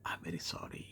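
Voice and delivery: whispered apology, barely voiced; secret whisper. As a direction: breathe it like a secret. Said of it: "ரகசியமாக கூறுவது போல்".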